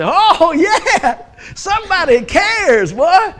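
high, loud eager delight at the blinking light; mock thrilled, laughing through it; hopeful excitement. Pastor acting his hopeful self seeing the light blink. Retold hopeful answering machine.

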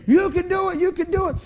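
high, loud cheering on a driver; crowd cheering. Retold or imitated. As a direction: shout encouragement like a fan. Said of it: "and they go".

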